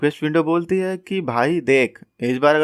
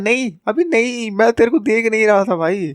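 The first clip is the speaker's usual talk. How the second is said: panicked shout; 7 semitones higher than usual. Retold or imitated. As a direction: yell it out, caught red-handed. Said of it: "चिल्ला के बोलता है".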